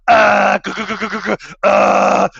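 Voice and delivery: loud high machine buzz and stutter; imitated MRI noise. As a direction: blare like a scanner, with rattling g's. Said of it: "It's not clicking and popping. It's like".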